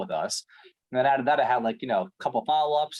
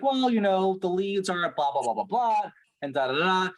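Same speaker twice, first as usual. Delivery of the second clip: higher-pitched, dismissive imitation of manager's excuses; mocking manager; 6 semitones higher than usual. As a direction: parrot the boss's waffling excuse.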